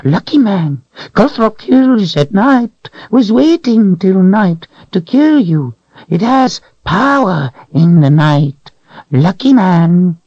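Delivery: high whining creature voice; creature whine. A narrator's reading. Creature whining to Richard about the Calthrop. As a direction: whine it out, cringing.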